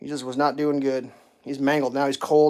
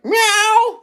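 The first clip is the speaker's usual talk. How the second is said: high, louder mock cat meow; high meow imitation; 8 dB louder than usual. Man imitating his cat's first meow, high and loud. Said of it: "he was kinda like".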